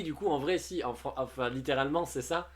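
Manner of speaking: ordinary talk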